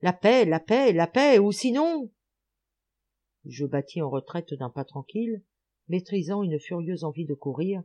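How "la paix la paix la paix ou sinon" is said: loud, high threatening shout; furious shouted ultimatum; shouted threat. A narrator's reading. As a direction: bellow a warning to leave. Giant keeps bellowing threats.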